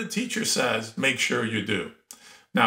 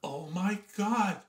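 acted disbelief; 7 semitones higher than usual. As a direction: play an aghast kid, rising into incredulity. Child aghast at misunderstood order.

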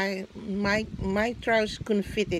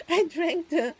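laughing; 7 semitones higher than usual. Real, not acted. Laughs at drinking too fast.

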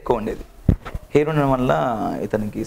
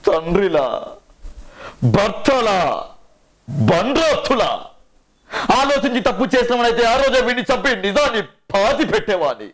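much higher, intense film-climax monologue, drawn out; Sai Kumar impression; 9 semitones higher than usual. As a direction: declaim a tragic hero's climax dialogue, building to anguished peaks. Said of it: "Sai Kumar గారి voice అంటే".

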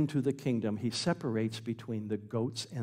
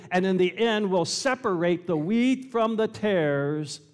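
raised, loud pulpit narration; full-force preaching; 7 semitones higher, 6 dB louder than usual. Real, not acted. Preacher tells congregation the parable of wheat and tares, full-force preaching. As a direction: drive the parable home, high and forceful.

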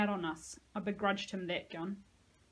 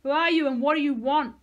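loud, higher holler; 6 semitones higher, 12 dB louder than usual. A narrator's reading. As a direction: shout it through the gate. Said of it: "I hollered".

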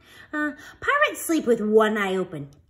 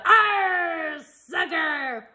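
high growling pirate cry; pirate yell; 7 semitones higher, much slower than usual. A narrator's reading. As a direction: yell it mid-kick. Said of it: "kicked the ball and yelled".

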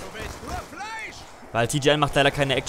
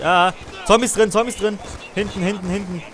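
quick, raised warning calls; urgent callout; 7 semitones higher than usual. Real, not acted. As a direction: warn your team fast. Zombie game, calling warnings.

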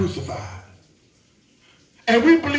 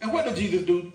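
ordinary delivery

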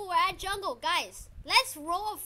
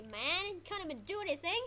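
normal talking voice

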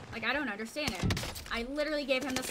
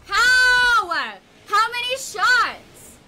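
loud, high outraged yell at the game; furious yelling; 7 semitones higher, 13 dB louder, much slower than usual. Real, not acted. Gamer yelling at the game over being killed, furious. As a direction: scream at the screen in disbelief.

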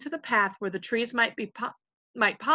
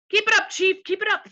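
high-pitched, eager egging-on; cheering chorus; 12 semitones higher, 7 dB louder than usual. A narrator's reading. Followers urging their invisible chief on, excitedly. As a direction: squeal support like a yes-man. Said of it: "the other voices said".

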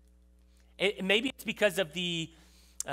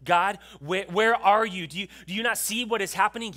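pleading lament; 3 semitones higher, 5 dB louder than usual. Acted. Habakkuk again demanding God answer him, loud lament. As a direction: cry it out, desperate for an answer. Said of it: "Habakkuk laments".

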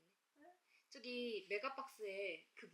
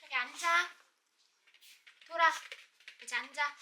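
dog command voice; 5 semitones higher, 13 dB louder than usual. Real, not acted.

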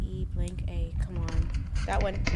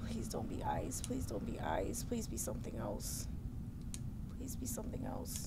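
soft pleading whisper; frightened whisper; 10 semitones lower, 9 dB quieter than usual. Real, not acted. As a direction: whisper a scared plea. Pleading at scary door.

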